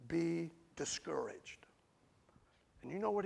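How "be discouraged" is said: slow hushed whisper on the words; a whispered scripture line. Acted. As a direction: breathe the warning out, slow. Quoting scripture, dropping voice.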